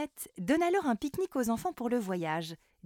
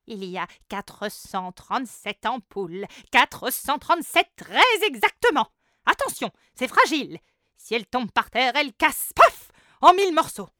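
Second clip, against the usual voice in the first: rising, loud fussy goblin; 3 semitones higher, 10 dB louder than usual. Acted. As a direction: warn anxiously like a fussy goblin. Fussy red-bearded goblin warns.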